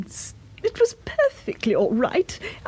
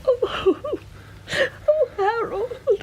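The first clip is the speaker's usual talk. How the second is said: slow, tearful sobs over the words; mild distraught sobbing at the cat's death; 6 semitones higher, slower than usual. Acted. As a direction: choke it out through tears.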